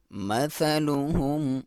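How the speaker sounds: slow tajweed demo; clear tha. Shown as a demonstration. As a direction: stretch مثلهم with a crisp, clear sa. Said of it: "clear sound ہو".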